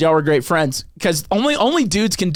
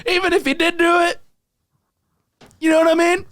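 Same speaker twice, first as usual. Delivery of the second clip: Dave Chappelle impression. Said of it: "he was like".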